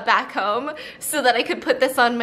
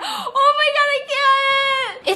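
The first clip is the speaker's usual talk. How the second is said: high, teary panic; half laughing, half crying; panicked; 12 semitones higher than usual. Real, not acted. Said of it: "when I anticipate pain".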